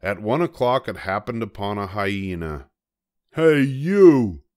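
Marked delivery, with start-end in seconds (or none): 3.3-4.4 high, loud, grumpy little bug; a tiny bug spoiling for a fight, high and loud; grouchy ladybug. A narrator's reading. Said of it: "said the grouchy ladybug".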